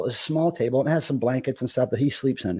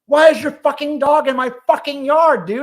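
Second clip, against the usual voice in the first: retold yell; 10 semitones higher, 6 dB louder than usual. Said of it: "said "fuck" ... really loud".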